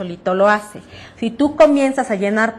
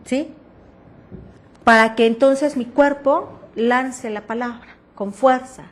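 ordinary talk, nothing marked

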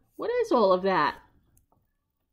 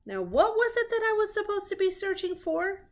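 much higher, befuddled detective voice; puzzled Sherlock; 11 semitones higher than usual. Acted. Sherlock forgets his own case, high and puzzled. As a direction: wonder aloud what you were looking for. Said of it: "Asked Sherlock Hemlock".

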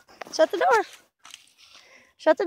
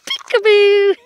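loud peekaboo; 5 semitones higher, 8 dB louder than usual. Real, not acted. Peekaboo game with baby.

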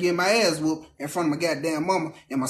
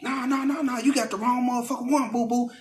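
sassy sister; 6 semitones higher than usual. Retold or imitated. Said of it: "my sister coming down".

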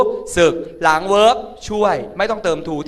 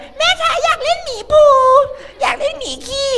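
put-on very high, louder little-kid plea; whining child; 5 dB louder than usual. Child begging mother to play with Pooh bear, high childish voice. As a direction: squeal it like a child begging mum.

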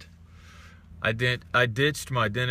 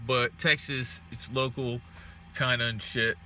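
ordinary talk

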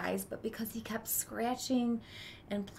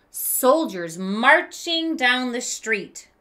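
gentle read-aloud storytelling voice; story narration; 11 dB louder, slower than usual. A narrator's reading. Teacher reading a picture book to kids, storytelling voice. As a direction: read it like a children's book to the class.

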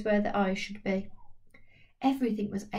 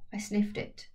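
ordinary delivery

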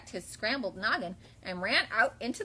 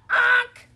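high, loud "onk" squawk; startled squawk; 14 semitones higher, 14 dB louder than usual. Acted. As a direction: honk out a bumped hen's cry. Goose gets bumped.

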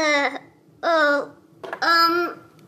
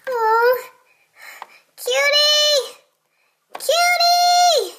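calling out; 6 semitones higher, much slower than usual. Acted. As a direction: yell for your friend in panic. Calling her friend for help.

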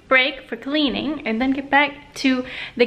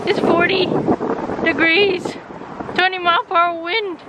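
gasping in icy wind; 6 semitones higher than usual. Real, not acted. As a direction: call out over cold wind.

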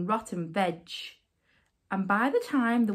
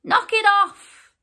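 high, furious shouted order; indignant storybook knight calling out loudly; loud cry; 10 semitones higher, 7 dB louder than usual. A narrator's reading. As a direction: shout to halt a crowd. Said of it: "he cried loud".

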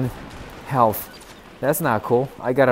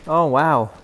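usual speaking voice

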